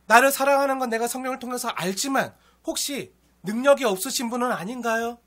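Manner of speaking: a believer's loud plea, asking God why; anguished prayer. Retold or imitated. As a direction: cry out at God in frustration. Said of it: "하고 물어볼 수 있겠죠".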